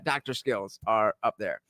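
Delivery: ordinary talk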